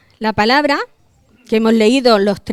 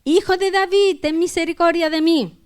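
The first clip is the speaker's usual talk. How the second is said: insistent plea, pitched high; crying out harder; 7 semitones higher than usual. A narrator's reading. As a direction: raise the voice right up to beg for mercy. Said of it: "él clamaba mucho más". (clamando con más insistencia, voz aguda)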